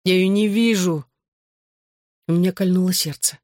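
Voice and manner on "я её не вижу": groggy, mildly whining moan; plaintive appeal to mother; moaning complaint. A narrator's reading. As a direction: moan it like a drugged patient. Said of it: "простонал он".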